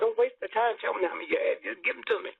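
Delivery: high, insistent hurry-up; urgent. Real, not acted. Elder urging host to stop wasting time, insistent. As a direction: cut the delay short, urgently.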